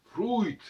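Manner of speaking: "Fruit" said "frou-it", high and loud; old pronunciation. Shown as a demonstration. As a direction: stress "Fruit" with an ou sound. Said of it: "ça se prononce ou". ("Fruit" dit "frou-it", plus haut)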